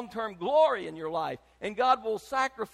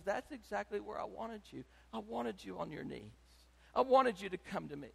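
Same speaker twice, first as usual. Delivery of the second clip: much softer, tender voice of God; God's gentle reply; 9 dB quieter than usual. Retold or imitated. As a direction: say it softly, like God comforting. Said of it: "And God says".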